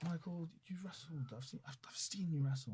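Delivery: hushed unvoiced retelling of Regal. Quoting Regal recognising him.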